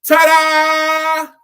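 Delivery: high, very loud showman ta-da; ringmaster ta-da. Retold or imitated. Preacher acting out the acrobats for the congregation, big showy ta-da. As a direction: belt it out like a circus performer. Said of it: "and then".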